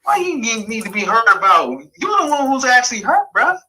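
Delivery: high-pitched indignant comeback, mildly hammed; retold retort. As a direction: fire back in a high, incredulous pitch. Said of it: "I'm like".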